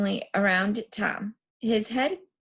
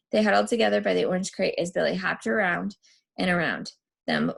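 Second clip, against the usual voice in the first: ordinary delivery, nothing marked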